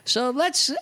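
ordinary delivery

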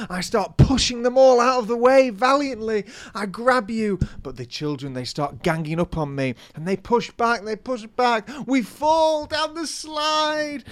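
excited storytelling. Pretend. Acting out ball pit story.

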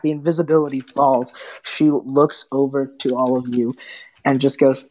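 ordinary talk

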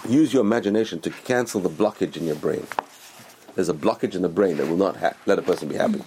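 usual speaking voice

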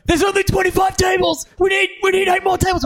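shouting. Retold or imitated. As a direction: bellow it out in a frenzy. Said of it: "He just keeps shouting out".

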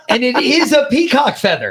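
high, laughing disbelief; laughing out the punchline. Real, not acted. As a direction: crack up as you say it. Cracking up telling the story.

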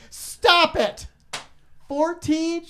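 high, loud stunned Stop it; can't believe what he hears; stunned disbelief. Retold or imitated. A host retells his disbelief at the caller's answer, high and loud.

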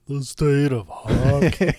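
slow, booming announcer-style echo; grand proclamation. Pretend. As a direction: proclaim it like a slogan. Grand echo of hockey state slogan.

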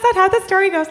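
sing-song it up high; mock high-pitched retake. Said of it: "that's not how the story goes".

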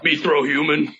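dumb brute talk, shouted; big green monster; Hulk voice. Acted. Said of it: "act out with your voices the best Hulk".

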